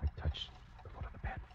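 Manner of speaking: near-silent whispered narration; hushed suspense. A narrator's reading. Killer telling how he crept to the bed, whispering. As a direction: barely breathe the words, creeping closer.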